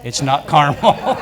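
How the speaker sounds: slow, laughing delivery; laughing through it. Retold or imitated. Teller delivering the husband's punchline, laughing. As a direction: let the laugh break into the punchline.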